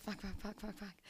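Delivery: hushed string of curses, dropping away; muttered panic. Retold or imitated. Dreading mum's call about bills, muttered quietly. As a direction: swear under your breath in dread.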